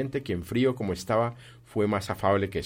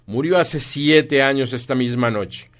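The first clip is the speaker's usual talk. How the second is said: loud, slow, higher pronouncement; stern Scrooge; 5 semitones higher, 9 dB louder, slower than usual. Acted. Scrooge tells visitors when his partner died, slow and loud. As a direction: weigh each word loudly.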